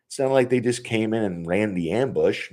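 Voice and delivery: usual speaking voice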